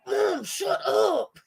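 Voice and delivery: high, drawn-out mock whine; whining embarrassed kid. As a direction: whine like a mortified kid at a parent. Imitates embarrassed kid she enjoys.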